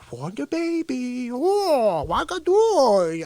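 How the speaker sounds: slow goofy Sandler whine; mock Sandler baby voice. Comedian trying an old goofy Adam Sandler voice. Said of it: "What Sandler would I be doing".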